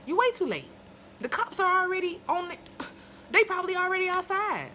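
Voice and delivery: high, loud scolding; exasperated yell. Real, not acted. Reviewer telling Faye she's too late, loud and high. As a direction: shout the warning she missed. Repeats line with more force.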